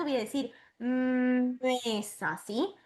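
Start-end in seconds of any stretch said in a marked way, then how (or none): 0.8-2.4 long hummed "mm" leading into "mesa"; "mesa" with a stretched-out initial m; lengthened M sound. Shown as a demonstration. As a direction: hold the first consonant of "mesa" before finishing the word. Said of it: "Siempre alargando ese sonido".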